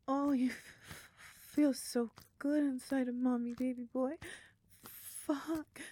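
high, loud and breathless; moaning climax. Acted. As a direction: cry out in rising pleasure.

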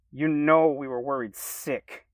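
slow, loud scolding. Acted. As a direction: scold him like a worried friend. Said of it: "Kevin practically scolded me".

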